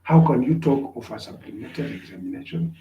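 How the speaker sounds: ordinary talk, nothing marked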